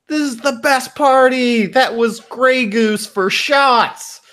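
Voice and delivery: very high, excited squeal; drunk party girl. Acted. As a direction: stretch it out like a tipsy girl partying. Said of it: "and they're like".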